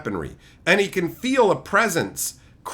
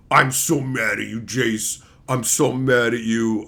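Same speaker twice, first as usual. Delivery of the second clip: mock anger; 9 semitones lower than usual. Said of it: "is, like, so mad".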